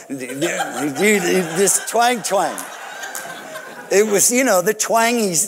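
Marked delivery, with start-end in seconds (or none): 0.1-2.6 slow, drawn-out country twang, half laughing; mocking twang. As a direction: stretch twang twang out slowly like a country singer, chuckling. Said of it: "And now I'm like".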